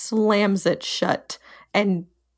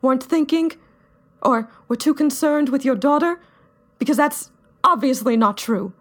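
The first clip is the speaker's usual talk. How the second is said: put-on angry young fighter; 4 semitones higher than usual. [name]'s long angry rant in character.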